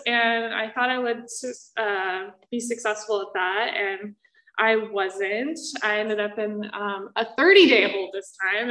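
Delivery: ordinary talk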